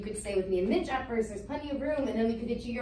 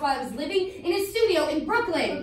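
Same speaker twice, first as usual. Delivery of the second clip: raised, insistent voice; indignant, emphatic justification; heated self-defence; 4 semitones higher, 4 dB louder than usual. Acted. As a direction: defend yourself hotly, point by point. Sister defends herself in quarrel.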